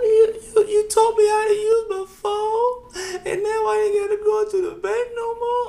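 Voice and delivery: put-on wailing high, slow, tearful old mother voice; crying old mom. Mother cries over her son teaching her the phone, high wailing sob. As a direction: sob it out like a grateful elderly mom. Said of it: "breaks down and starts crying".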